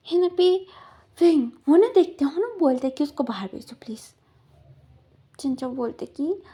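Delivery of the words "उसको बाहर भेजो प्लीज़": soft urgent whisper; whispered plea. Retold or imitated. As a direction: whisper it, begging quietly. Man quietly asks to send him out. (फुसफुसाकर विनती)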